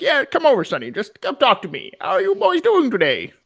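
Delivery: put-on high, loud whimsical old codger; cackling toothless ranting old man. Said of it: "old guy is just like".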